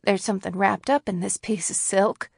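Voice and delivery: ordinary delivery, nothing marked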